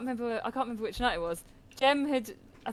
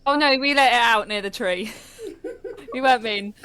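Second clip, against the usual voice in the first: laughing while protesting; 3 semitones higher, 6 dB louder than usual. Real, not acted. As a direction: giggle through the denial. Laughing defence.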